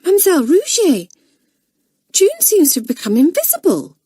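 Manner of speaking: put-on high, quick, fearful girl; scared voice. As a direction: sound alarmed about the vanished friend. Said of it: "said in a scared voice".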